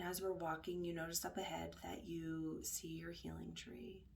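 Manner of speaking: hushed, unhurried guiding voice; guided meditation. Acted. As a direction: guide them gently inward, leaving space between phrases.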